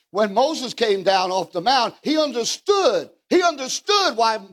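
fervent sermon climax; preaching. Real, not acted. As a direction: preach it, building to a cry. Preacher building to shout.

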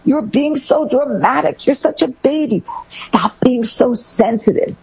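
put-on high, slow scolding of a crying child; mock a parent brushing off tears; slow, high-pitched scolding parent; dismissive parent. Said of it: "I've certainly heard other parents say it".

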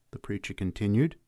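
usual speaking voice

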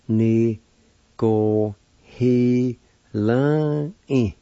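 "Ni go he la i" very slow, syllables held apart. Shown as a demonstration. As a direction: sound out "Ni go he la i" in long pieces. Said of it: "it's pronounced like this".

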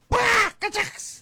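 high, slow shouted outburst; mock angry outburst. Wife snaps at her husband in a high, slow mock outburst. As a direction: burst out high and slow in indignation. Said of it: "Straight away it went".

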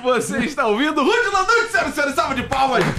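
high, rousing host announcement; show announcer intro. Real, not acted. Host introduces the guest to listeners, rousing announcer voice. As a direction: build up the guest for applause.

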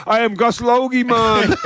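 hammed-up Caribbean lilt; Gus Logie. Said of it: "Find a West Indian cricketer".

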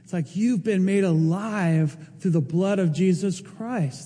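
ordinary talk

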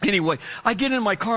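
ordinary delivery

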